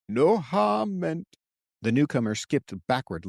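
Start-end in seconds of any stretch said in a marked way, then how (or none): 0.1-1.4 put-on old man voice.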